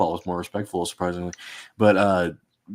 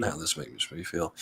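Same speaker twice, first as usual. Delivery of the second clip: much softer, a queasy low mumble; uneasy mutter; 3 semitones lower, 10 dB quieter than usual. Retold or imitated. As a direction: murmur it like a bad feeling sinking in. Retelling own uneasy reaction.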